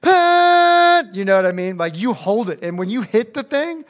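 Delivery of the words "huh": long, loud, high held honk noise; angry honk imitation. Preacher showing teens an angry driver's horn, loud and held. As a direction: lean on the horn with your voice. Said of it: "that honk's more of like a".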